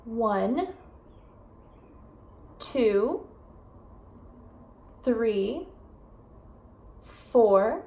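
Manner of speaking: slow, careful counting; clear number demo. Shown as a demonstration. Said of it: "Let's start with one".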